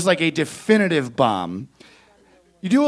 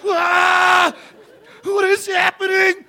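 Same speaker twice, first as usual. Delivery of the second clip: high, loud laughing wail; anguished child; 4 dB louder, slower than usual. Retold or imitated. Comic voicing kids' new awkwardness, wailing high. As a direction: wail it like a kid feeling awkward for the first time. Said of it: "They were like".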